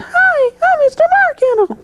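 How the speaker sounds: a high silly mascot voice he hates doing; Mister Barkettle voice. Retold or imitated. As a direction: squeak it out like the kids' show character. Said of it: "always wanna hear my voice".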